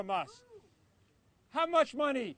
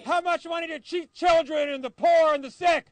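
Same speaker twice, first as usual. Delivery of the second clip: higher, louder call to the crowd; shouted call for a crowd's response; rally shout; 5 semitones higher, 7 dB louder than usual. Real, not acted. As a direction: fire the question out to rouse a crowd.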